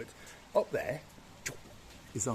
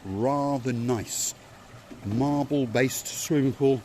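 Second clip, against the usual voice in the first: slow savouring drawl; 2 semitones higher, 6 dB louder, slower than usual. Real, not acted. Savouring the hotel pool description.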